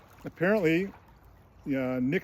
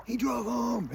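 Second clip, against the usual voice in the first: high-pitched incredulous exclamation; mock reveal; 9 semitones higher, slower than usual. Real, not acted. Telling listeners the drinker drove, high mock reveal.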